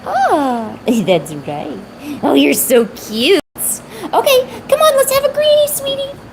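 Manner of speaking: high, sing-song cooing to a pet; gushing baby talk to a cat. Acted.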